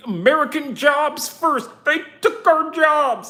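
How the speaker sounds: high, loud mocking yell of a protester; hammed-up high, loud "they took our jobs" protester; mock American protester. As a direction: shout it like an angry patriot caricature.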